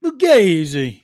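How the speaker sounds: slow, loud mock Italian Fugazi; Italian accent drawn out and loud; Waco Italian accent. Real, not acted. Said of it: "with that Waco Italian accent of his".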